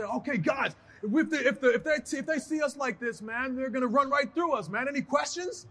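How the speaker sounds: a hint of a rallying captain; Triple H pep talk. Wrestler imitating Triple H rallying the team, a mock pep talk. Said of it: "Triple H will say".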